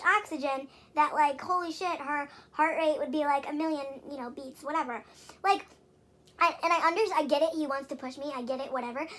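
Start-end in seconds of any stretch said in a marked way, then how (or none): none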